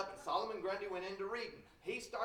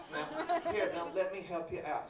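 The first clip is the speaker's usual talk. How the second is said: ordinary delivery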